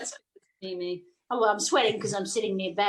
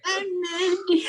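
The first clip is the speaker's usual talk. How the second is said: higher, slow wailing witch; melting witch; 8 semitones higher, slower than usual. Pretend. Joking about heat, as the melting witch, higher and slow. Wicked Witch line quoted.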